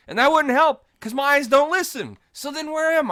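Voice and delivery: loud, high-pitched comic protest; hammed-up shrieking exasperation; exasperated mock rant. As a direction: rant at the top of the voice. Exaggerated rant about the annoying voice.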